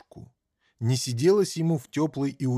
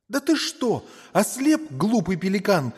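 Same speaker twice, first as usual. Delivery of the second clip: сердитый мальчик; 8 semitones higher, 4 dB louder than usual. Acted. Мальчик кричит на пеликана.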